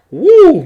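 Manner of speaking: loud, high whoop of joy; celebration whoop. Real, not acted. Host celebrating the Super Bowl result, loud high whoop. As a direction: let out a big happy woo.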